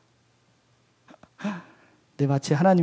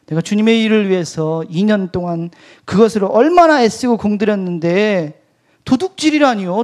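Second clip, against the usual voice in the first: louder, higher indignant protest; raised angry retort; angry outburst; 4 semitones higher, 7 dB louder than usual. Retold or imitated. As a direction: snap back at God, offended. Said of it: "화를 버럭 냈어요".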